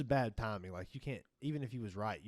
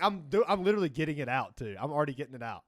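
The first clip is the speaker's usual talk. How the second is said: defensive protest; 4 semitones higher, 6 dB louder than usual. Retold or imitated. Apprentice protesting, retold.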